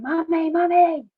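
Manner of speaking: retold child's scream. Frightened child calling for mum from the tray, retold scream. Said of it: "I just started screaming".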